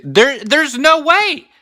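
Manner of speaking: high, slow disbelieving cry; there's no way, said up high and drawn out; incredulous. Real, not acted. Incredulous reaction.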